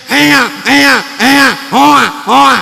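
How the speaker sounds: loud mock baby wail oa oa; baby wail as heard by parents. Sinful ears hear the newborn's question as a loud mock wail. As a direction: bawl it like a crying baby. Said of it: "kupingnya orang tua kita udah kebanyakan dosa".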